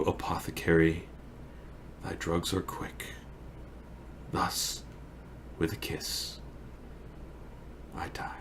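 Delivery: fading to a whisper; soft, halting last breaths with long pauses; dying Romeo. Acted. Romeo drinks the poison and dies, fading away.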